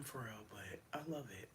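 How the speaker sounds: whispered aside. Real, not acted. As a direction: lean in and whisper it. Confiding aside.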